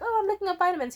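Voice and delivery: very high, loud mock-casual voice; chirpy self-quote. Quoting herself to boyfriend about vitamins, high and loud.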